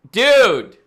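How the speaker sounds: loud, excited shout. Real, not acted. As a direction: burst out with excitement. Excited about matching lists.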